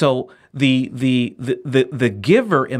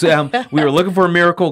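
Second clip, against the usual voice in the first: laughing; 3 semitones higher, 4 dB louder, faster than usual. Real, not acted. Repeating the joke while laughing.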